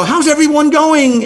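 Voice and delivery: high, drawn-out hello to the callers; cheery host greeting. Retold or imitated. Host greeting five hundred callers, cheery and drawn out. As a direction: greet the crowd like a bright host.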